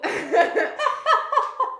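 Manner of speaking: loud high laugh; burst of laughter. Real, not acted. Recalling her boyfriend's joke, with a loud high laugh.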